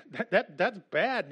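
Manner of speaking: real laughter through the words; can't help laughing while saying it. Real, not acted. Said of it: "I don't know why I'm laughing".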